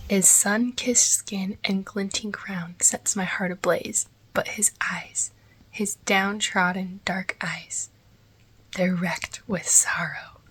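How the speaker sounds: hushed slow book reading; dramatic reading whisper. Shown as a demonstration. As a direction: read the excerpt like a secret. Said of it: "reads own book excerpt aloud".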